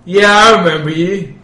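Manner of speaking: dopey cartoon bellow. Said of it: "the orc says".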